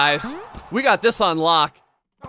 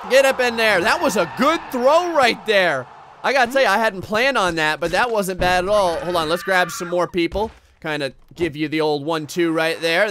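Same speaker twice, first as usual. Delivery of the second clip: usual speaking voice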